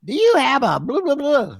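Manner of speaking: high, loud mock caller voice. Caller on the radio show, high loud mock voice. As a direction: pitch the caller way up and hammed. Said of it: "somebody called and said".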